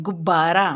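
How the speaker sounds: गुब्बारा with a doubled, pressed ब in the middle; stress on ब. Shown as a demonstration. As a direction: hold and hit the ब in गुब्बारा. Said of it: "जोर से ब बोलते हैं". (गुब्बारा में ब पर ज़ोर देकर)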